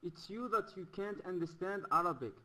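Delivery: ordinary talk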